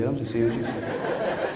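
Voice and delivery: a teasing chuckle at the crowd; laughing aside. Real, not acted. Joking with audience about amnesia.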